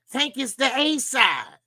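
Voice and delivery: high, slow taunting reminder; high-pitched playful needling; mock taunt. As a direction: spell it out in a high teasing voice. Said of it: "all seriousness".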